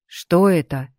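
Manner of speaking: ordinary delivery, nothing marked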